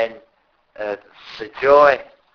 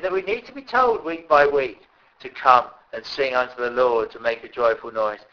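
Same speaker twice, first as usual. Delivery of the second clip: ordinary delivery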